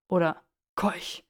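ordinary delivery

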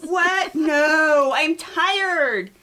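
high, loud acted objection; loud protest. A narrator's reading. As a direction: refuse loudly in disbelief. Acted protest to the two riders.

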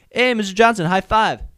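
loud, high, upbeat call; demonstrated greeting. Shown as a demonstration. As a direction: hail the principal with full enthusiasm. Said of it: "This is what it should sound like".

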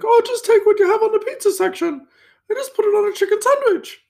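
mock posh falsetto, very high; really high-pitched voice. Front-of-house guy asking chef for a sandwich, high-pitched voice. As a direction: imitate a coworker's squeaky-high posh request. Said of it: "he just had this really high-pitched voice".

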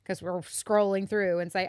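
ordinary talk

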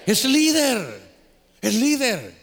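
loud, high ringing declaration; emphatic preaching. Real, not acted. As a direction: proclaim it to the congregation. (proclamación enfática)

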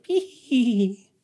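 very high-pitched imitated tittering; mock giggle. Strange woman walking away, imitated high giggle. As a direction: squeal out a tiny put-on "hee hee".

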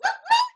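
high, loud double whoop; whoop-whoop of excitement; celebratory whoop. Real, not acted. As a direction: cheer with two high-pitched whoops. Cheering at the show's sign-off.

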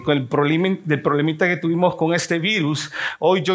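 ordinary delivery

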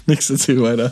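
sputtering laugh after swallowing wrong; cough-laugh through a choke; choking on a drink. Real, not acted. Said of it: "Ich hab mich verschluckt".

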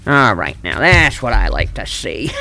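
ordinary talk, nothing marked

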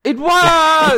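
high, loud outburst; shouted protest. Real, not acted. Arguing the lyric was a metaphor, shouted high. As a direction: fire back in loud disbelief. Heated mock argument over lyrics.

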